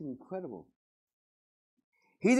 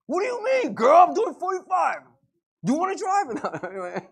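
much higher, shouted hammed-up outburst; mock yelling husband; 12 semitones higher, 10 dB louder than usual. Husband yells at wife over his speed, hammed-up shout. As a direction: blow up at her in the car.

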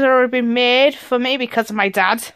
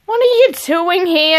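ordinary talk

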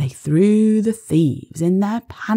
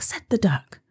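ordinary delivery

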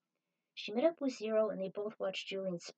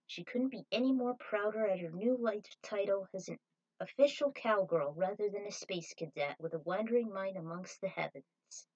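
plain reading voice

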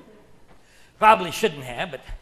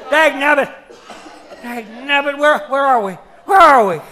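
put-on startled old codger; 3 semitones higher, 6 dB louder than usual. Old man jolts awake.

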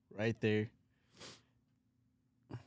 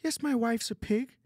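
high-pitched, strained Canadian Kermit voice; Jordan Peterson; 4 semitones higher than usual. Acted. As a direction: argue the case like a Canadian Kermit. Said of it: "he's like a Canadian Kermit".

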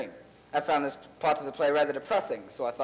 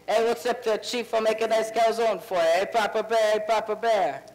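loud, higher New York sitcom guy; sitcom character; 6 semitones higher, 4 dB louder than usual. Retold or imitated. Recalls sitcom characters he copied as a kid. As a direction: do the catchphrase like a TV character. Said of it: "All those crazy characters".

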